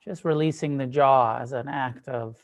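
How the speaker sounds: unhurried meditation guidance with long pauses; guided meditation. Acted. Teacher guiding a group to soften and relax, guided meditation. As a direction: ease them gently into stillness, leaving space between phrases.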